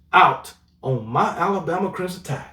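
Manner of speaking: ordinary talk, nothing marked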